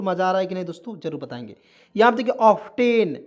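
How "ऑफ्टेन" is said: ऑफ्टेन with a hard T; wrong pronunciation. Shown as a demonstration. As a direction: say ऑफ्टेन as "off-TEN", loudly. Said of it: "ऑफ्टेन पढ़ना गलत है".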